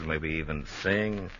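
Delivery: normal talking voice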